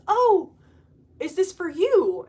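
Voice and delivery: a high, surprised "oh" then a rising question; airport lady. Retold or imitated. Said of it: "but she says".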